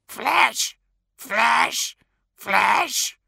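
high loud squawking crow voice; crow calls. Acted. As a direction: caw it like a hungry crow. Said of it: "they called back".

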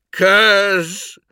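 high, loud call fuelled by anger; vigorous cry. A narrator's reading. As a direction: throw the name out with anguished force. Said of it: "With greater vigor this time".

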